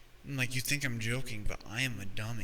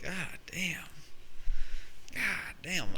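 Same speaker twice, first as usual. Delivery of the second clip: drawn-out disbelief; 6 semitones higher, slower than usual. Real, not acted. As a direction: let it hang in slow disbelief. Reacting to the absurd news.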